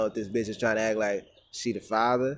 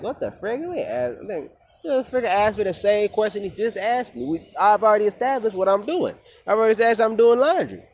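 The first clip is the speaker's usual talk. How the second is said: high-pitched, louder retold exasperation; exasperated; 10 semitones higher, 6 dB louder than usual. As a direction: vent at being asked the same thing twice. Retells exasperation at repeated question.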